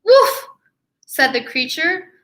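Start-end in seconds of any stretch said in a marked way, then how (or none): 0.0-0.5 high loud yappy woof; excited dog bark. Acted. Dog barks.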